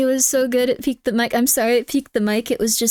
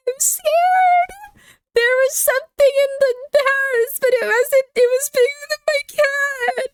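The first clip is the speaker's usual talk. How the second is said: mock panicked screaming; slower than usual. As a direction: ham up a panicking ghost-video victim. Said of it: "the manliest high-pitched screams ever".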